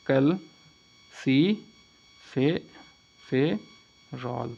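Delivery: Calciferol very slowly, broken into syllables with pauses. Shown as a demonstration. Teacher drilling the name of vitamin D, syllable by syllable. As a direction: dictate calciferol piece by piece. Vitamin D को क्या कहते हैं.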